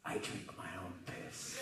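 low, breathless whisper down the phone; whispered low confession. Retold or imitated. Wild Man on the phone to Danny, low and whispered. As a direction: hush it like a secret.

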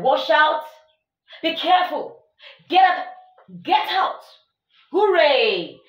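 a run of high, loud calls; strong exclamations. Shown as a demonstration. Teacher lists emotional exclamations, loud and high. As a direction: call each one out with force. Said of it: "such denote strong emotion".

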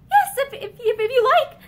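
high, loud, stammering with nerves; nervous stammer. Acted. Frightened wife forced to agree, stammering high and loud. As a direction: trip over the words in fear.

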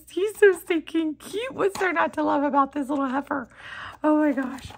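normal talking voice

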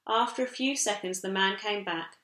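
ordinary delivery, nothing marked

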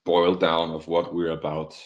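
normal talking voice

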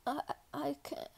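soft, weak, halting voice; faint stammer. Acted. Cadet about to faint before the corporal, weak stammer. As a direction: stammer it out with little breath.

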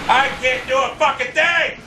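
high, drawn-out helpless yell; exasperated shout. Real, not acted. Gamer unable to act through lag, exasperated shout.

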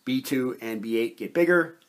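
normal talking voice

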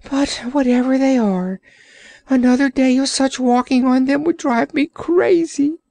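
high, whiny creature voice; self-pitying Orc. Acted. Orc pitying himself to the sailor, high whine. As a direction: moan about suffering as the Orc.

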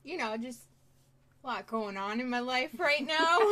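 usual speaking voice

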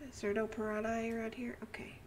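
soft searching whisper. Real, not acted. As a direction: whisper the question to yourself. Searching quietly.

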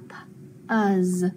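"p-" alone, pause, "uz" with short u; sounded out. Shown as a demonstration. Teacher sounding out a short U, sounded out. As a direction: sound out "p- ... uz" in pieces. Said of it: "it's gonna be short".

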